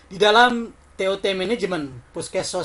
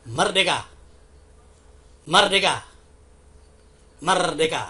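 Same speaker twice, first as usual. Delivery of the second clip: shouted rally cry, each one louder; rally speech; 2 semitones higher, 7 dB louder than usual. Real, not acted. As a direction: call it out to the crowd. Rally greeting, shouted freedom call.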